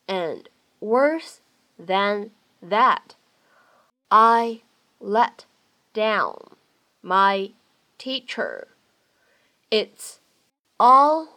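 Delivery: very slow, each word separate, pause after every one. Shown as a demonstration. Teacher modelling dialogue for English learners, slow word-by-word reading.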